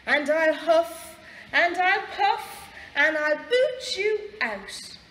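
slow, loud, mounting bluster; blustering threat. A narrator's reading. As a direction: build a big storybook threat, word by word. Pig threatens the wolf in story.

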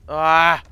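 frustrated yell. Real, not acted. Frustrated outburst while playing.

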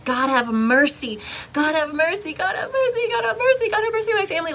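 repeating a prayer through sobs, voice climbing; desperate pleading prayer. Retold or imitated. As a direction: cry out to God, climbing in pitch. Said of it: "all I kept saying was".

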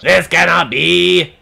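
overacted doom, drawn out; dramatic villain line. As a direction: ham it up like a defeated villain. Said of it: "I'm such a good voice actor".

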